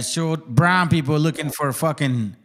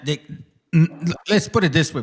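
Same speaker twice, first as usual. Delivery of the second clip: ordinary talk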